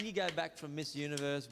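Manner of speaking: ordinary delivery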